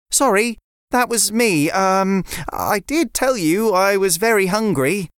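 put-on a sensible boy explaining gently; a hint of a child lecturing a friend; young boy River. River voiced.

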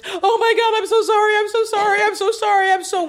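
high, loud, frantic sorry-sorry; mock-frantic Eden pleading; frantic apology. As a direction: gush apologies in a panic. S2 acting Eden's frantic apology.

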